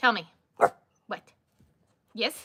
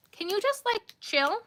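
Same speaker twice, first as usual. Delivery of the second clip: talking to dog; 11 semitones higher than usual. Real, not acted.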